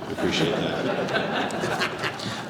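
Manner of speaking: breathy laugh through the words. Real, not acted. Laughs at being put last.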